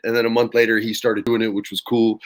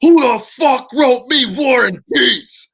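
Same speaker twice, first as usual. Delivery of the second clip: higher, louder, slow exasperated roar; furious outburst; 12 semitones higher, 6 dB louder, slower than usual. Retold or imitated. Big Show storming into the writers' room, a much louder, higher angry yell. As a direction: bellow it like a giant waving too many pages.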